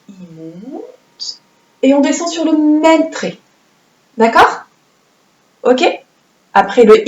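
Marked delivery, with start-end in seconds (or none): 0.1-1.4 softly drawn-out demo. Shown as a demonstration. Teacher showing children the right stroke, hushed. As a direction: say il monte much softer, stretching monte. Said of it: "Un I, ce n'est pas comme ça".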